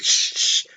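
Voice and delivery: unvoiced shushing blade noise; imitated knife scrape. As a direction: mimic metal scraping on metal. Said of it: "when the knife hits another knife".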